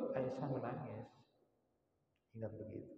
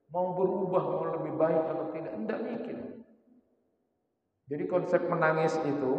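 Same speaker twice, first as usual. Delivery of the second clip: fervent preaching; 6 semitones higher, 6 dB louder than usual. Real, not acted. Preacher heats up about companions' worry.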